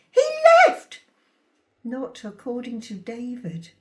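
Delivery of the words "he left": high burst of protest; shouted retort. A narrator's reading. Mother insisting the father walked out, shouting. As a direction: fling it back at the accuser. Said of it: "I shouted".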